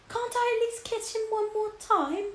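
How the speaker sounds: high, tearful plea, almost breaking; sobbing. Acted. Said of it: "sobbed Missus Beamish".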